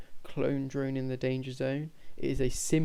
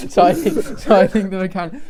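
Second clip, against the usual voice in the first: laughing; 7 semitones higher, 13 dB louder than usual. Real, not acted. Laughing fit mid-sentence.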